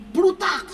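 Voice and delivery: sharp, high, loud cracking-bone noise; imitated crack. Preacher imitates the second spine crack, high and loud.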